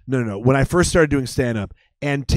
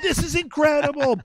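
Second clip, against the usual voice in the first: high, amazed exclamation; starstruck awe; 11 semitones higher than usual. Retold or imitated. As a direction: gush like a thrilled teenager. Starstruck teens reacting.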